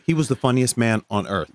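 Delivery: ordinary talk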